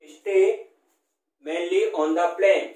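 slow chunked reading. Shown as a demonstration. Teacher dictates the assonance example slowly to students. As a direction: dictate phrase by phrase, hitting the ay vowels. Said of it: "यानी रेन, Spain".

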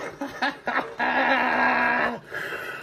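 high shrieking witch cackle; evil cackle. Acted. Villain's evil laugh.

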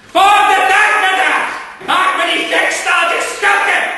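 high, loud, furious roar; bellowing. Acted. Gentleman roaring at the plants to hand her over. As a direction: roar your demand at the villains. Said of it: "Hij brulde".

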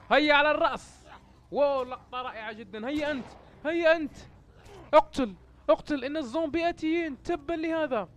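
loud, high combat yelling; high-pitched battle frenzy; frantic shouting. Real, not acted. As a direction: shout urgently as enemies close in. Shooting at oncoming zombies.

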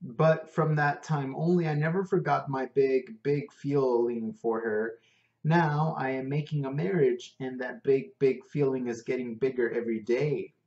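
lightly voiced Indian cabbie, earnest; a hint of an Indian English lilt; Indian accent. Prabhu confesses his love.

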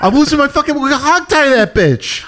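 very high, frantic impression; hammed-up outburst, losing it; Mark Wahlberg. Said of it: "Do you think Mark Wahlberg's".

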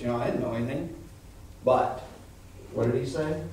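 normal talking voice

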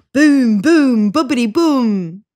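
loud booming chest drum; drumming sound. Acted. Baboon drumming his chest, loud and rhythmic. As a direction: beat it out like a drum. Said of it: "drummed a wild rhythm".

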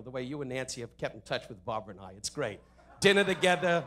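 ordinary delivery

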